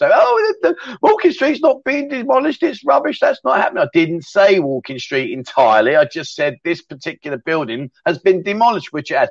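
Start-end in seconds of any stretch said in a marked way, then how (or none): 0.1-3.8 very high, squeaky mock complainer; mocking whiny critic. Mimicking viewers upset about Walking Street, high whiny mock voice. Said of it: "people with their knickers in a twist".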